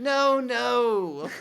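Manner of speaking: high, loud drawn-out British refusal; hammed-up chef's protest; Gordon Ramsay. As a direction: cry out like an exasperated TV chef. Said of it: "I just do Gordon Ramsay".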